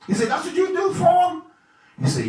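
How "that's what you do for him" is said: a king feigning surprise at Haman's advice; surprised king. Acted. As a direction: ask it in disbelief.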